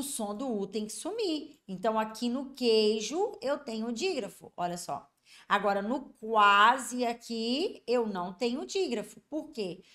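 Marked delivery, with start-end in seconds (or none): 6.2-7.1 QUASE drawn out with a clear KW sound; U pronounced. Shown as a demonstration. Teacher contrasts it with queijo, stressed U glide. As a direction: hit "quase" slow and louder, sounding the U. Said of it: "eu tenho que pronunciar o som do U".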